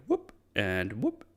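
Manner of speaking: short whoop swooping sharply upward; imitated whoop. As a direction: mimic a quick leap with a rising whoop. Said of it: "Goes like".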